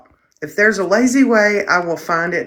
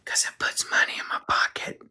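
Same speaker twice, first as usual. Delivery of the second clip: softer sly whispered aside; Ryan Anderson whisper; 10 dB quieter than usual. Retold or imitated. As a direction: whisper it like a secret money grab. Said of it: "let me pull a Ryan Anderson here".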